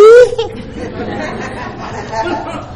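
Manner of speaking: a short high laugh of disbelief; breathy high chuckle; rueful laugh. Real, not acted. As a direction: laugh it off, half hurt. Laughs at being called she.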